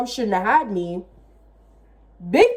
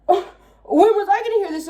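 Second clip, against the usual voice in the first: incredulous; 6 semitones higher than usual. Retold or imitated. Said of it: "I was looking at them like".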